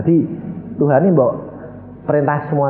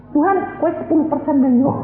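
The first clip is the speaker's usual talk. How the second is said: higher, sly haggling voice; mock bargaining prayer; 12 semitones higher than usual. Preacher mocks a believer haggling over the tithe, higher sly voice. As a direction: haggle with God like a cheeky trader.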